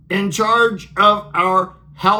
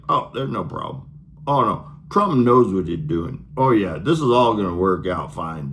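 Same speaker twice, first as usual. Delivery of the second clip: lower, smug breezy TV calm; mock reassurance; 10 semitones lower, faster than usual. Bessent soothing viewers on TV, smug mock calm. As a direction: brush it off like a slick spokesman. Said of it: "He goes on T V and he's like".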